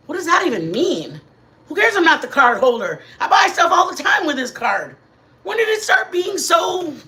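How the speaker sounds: loud, exasperated outburst; annoyed rant. Real, not acted. Ranting to viewers about the rejected order, loud and exasperated. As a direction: vent it loud, fed up with the store. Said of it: "Now I'm mad at them".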